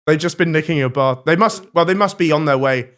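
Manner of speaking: ordinary talk, nothing marked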